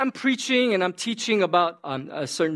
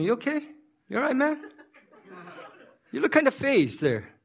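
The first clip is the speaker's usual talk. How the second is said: offhand concern; 7 semitones higher than usual. Acted. Casual check on offended man.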